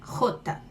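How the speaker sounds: "Rota" with a throaty scraped j sound; scraped jota. Shown as a demonstration. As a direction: start "Rota" with a raspy jota. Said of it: "arranhada como re".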